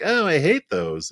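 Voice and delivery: lightly voiced little kid; kid's whine. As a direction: play the fussy kid at the bakery. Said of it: "the kid's like".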